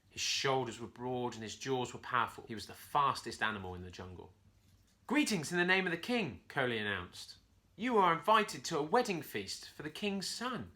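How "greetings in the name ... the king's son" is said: bright, raised little monkey voice; proud herald monkey announcing. Acted. Monkey messenger announces invitation.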